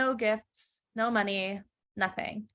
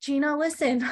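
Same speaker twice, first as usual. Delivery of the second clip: pleading father; 6 semitones higher than usual. Retold or imitated. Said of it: "the man says".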